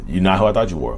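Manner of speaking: ordinary delivery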